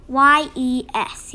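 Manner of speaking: usual speaking voice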